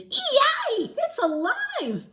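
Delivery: high, loud shriek of delight; lady shrieking the doll is alive; laughing scream. Acted. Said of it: "the lady screamed".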